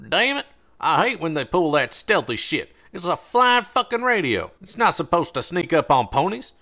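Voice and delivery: high, loud annoyed swearing; cursing rant. Acted. Slaver fumes after the bot escapes, loud cursing. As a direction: spit out the frustration. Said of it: "free flow of crude profanity".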